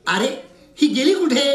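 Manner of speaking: ordinary talk, nothing marked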